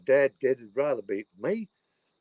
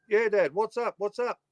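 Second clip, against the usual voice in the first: higher call answering dad next door; calling back; 9 semitones higher than usual. Retold or imitated. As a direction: call through the wall so he hears. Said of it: "And I go".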